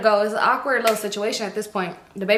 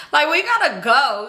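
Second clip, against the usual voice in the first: urgent insistence; 6 semitones higher, 4 dB louder than usual. Retold or imitated. Urging friends to leave.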